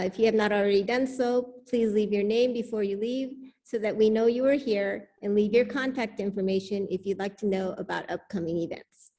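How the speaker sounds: usual speaking voice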